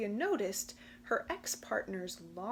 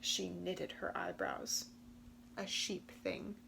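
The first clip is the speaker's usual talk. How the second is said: usual speaking voice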